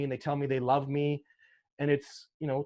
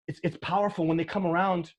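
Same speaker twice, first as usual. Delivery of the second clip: normal talking voice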